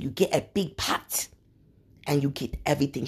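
soft, hushed African auntie voice; African accent. Retold or imitated. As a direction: lean in gently with an African lilt. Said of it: "And she said".

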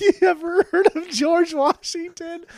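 high, loud mocking voice of a Trump supporter. Podcast host mocking a Trump supporter, high and loud. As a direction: sneer it out as a smug Trump fan. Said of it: "somebody who supports Donald Trump would say".

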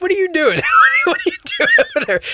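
high, loud, laughing squeal of disbelief. Retold or imitated. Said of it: "I was like".

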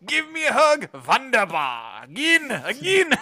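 high, loud mock German toy; German Furby toy. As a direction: play a demanding toy with a German accent. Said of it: "original Furbies were called Furbskins".

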